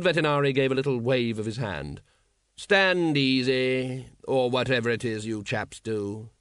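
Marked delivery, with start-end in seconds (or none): 2.6-6.3 slow, drawn-out aristocratic ruler; suave patrician. Acted. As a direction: drawl it like a bored, polished tyrant. Patrician's voice in narration.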